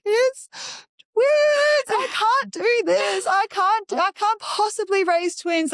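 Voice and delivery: very high, loud crying-style lament; mock tearful wail. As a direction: wail it out, overwhelmed, imitating your own meltdown. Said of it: "two minutes of crying".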